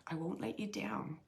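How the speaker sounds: a soft unvoiced whisper; whispered repeat of his words. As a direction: breathe it like a secret. Repeating the spirit's message.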